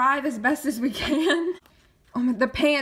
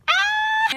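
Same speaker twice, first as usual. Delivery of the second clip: high, loud short scream; excited scream; 8 dB louder than usual. Real, not acted. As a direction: scream out in delight. Sudden fan excitement.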